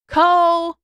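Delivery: loud high call into a dark cavern. A narrator's reading. Aang calling into the empty cavern, loud call. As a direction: shout the name to find him. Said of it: "he called out".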